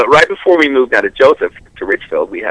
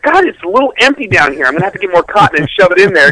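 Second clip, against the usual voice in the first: higher, fast chatty mom; lightly voiced mother fussing over the doll; 6 semitones higher than usual. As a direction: mom chattering while sewing, a hint of a sweet mother. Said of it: "She's like".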